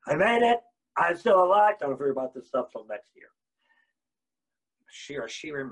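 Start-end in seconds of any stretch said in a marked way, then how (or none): 0.1-1.8 loud, higher mock relief; hammed-up relief; relieved exclaim. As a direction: blurt it out like you survived. Mocking relief after the fast.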